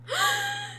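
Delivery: sudden high frightened gasp; sharp gasp. Acted. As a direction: catch your breath in fright. Rabbit starts chasing the player.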